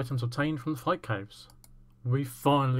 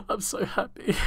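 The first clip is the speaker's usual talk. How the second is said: laughing whisper; 8 semitones higher than usual. Real, not acted. Laughs around the line.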